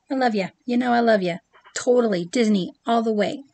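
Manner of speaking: ordinary talk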